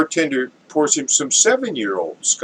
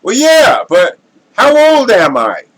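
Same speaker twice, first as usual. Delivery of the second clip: much higher, much louder punchline bark; drunk fella; 12 semitones higher, 9 dB louder than usual. Acted. Drunk to connoisseur, high loud gleeful comeback. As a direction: deliver the punchline like a rowdy barfly. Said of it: "this drunk fella".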